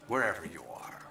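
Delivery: whispered aside. Acted. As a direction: whisper it as if just for him. Speech aside to absent commander.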